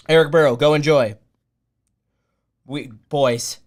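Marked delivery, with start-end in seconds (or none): none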